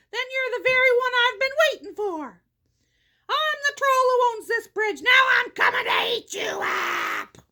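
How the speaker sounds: high, terrible troll shout. A narrator's reading. Troll who owns the bridge threatening to eat the goat, shouted menace. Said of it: "shouting at him in his great, terrible voice".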